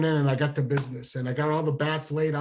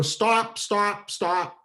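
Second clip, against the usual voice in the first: mock urgent yell; 6 semitones higher, much slower than usual. Yelling at parents to stop car.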